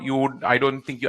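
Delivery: ordinary talk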